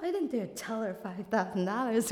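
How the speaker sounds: ordinary talk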